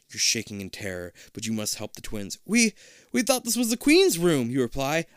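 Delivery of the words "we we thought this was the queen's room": high, loud stammering fright; scared stammer. Acted. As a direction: stammer it out in fear. Scared kid answers creature.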